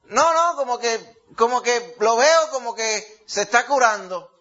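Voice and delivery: mucho más alto, negándolo con confianza; autoengaño confiado. Retold or imitated. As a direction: insistir en que se cura, agudo. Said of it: "decía yo". (negando el problema en tono alto y confiado)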